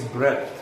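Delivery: "breath" rhymes with death; short e vowel. Shown as a demonstration. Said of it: "यहाँ हम इसको ब्रीथ नहीं पढ़ेंगे".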